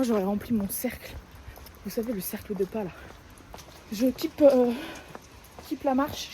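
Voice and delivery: usual speaking voice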